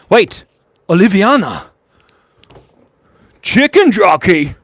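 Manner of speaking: high, loud exclamations; calling out. Real, not acted. Streamer reacting to chat, shouting out loud. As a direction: shout each one as a sudden burst.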